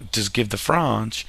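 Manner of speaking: "French" drawn out as "Frahnsh", mock French; playful faux-French "Frahnsh"; mock French accent. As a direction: put on a slow, joking French accent for "French". Jokey French pronunciation, then corrected.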